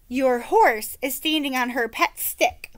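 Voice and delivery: ordinary delivery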